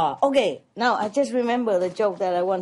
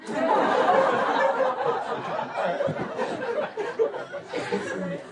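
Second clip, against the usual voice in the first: real high-pitched giggling fit; laughing at his own punchline; high giggle. Real, not acted. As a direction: burst into helpless high laughter. Speaker cracks up at own joke.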